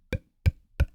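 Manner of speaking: "p" tiny and unvoiced, no air; small tight "p, p, p"; tight French P. Shown as a demonstration. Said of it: "very, very small, you know, tight".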